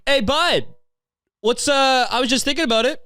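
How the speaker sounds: high-pitched calling out; mock heckling. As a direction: call across the room, needling the guy. Acts out hailing the dad onstage.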